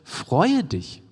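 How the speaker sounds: emphatic command with a high peak on FREUE; imperative, leaning hard on Freue; command form. Shown as a demonstration. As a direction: punch FREUE high, then drop on dich. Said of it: "eine Befehlsform, wo es heißt".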